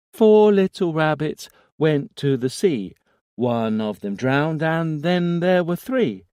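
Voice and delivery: put-on high, loud childlike rhyme; eerie child chanting; little boy voice. A narrator's reading. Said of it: "small, infantile voice".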